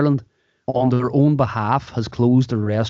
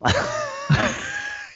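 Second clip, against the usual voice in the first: tiger roar; 6 dB quieter than usual. Pretend. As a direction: roar like a tiger, half laughing. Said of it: "I can do a good Tiger impression".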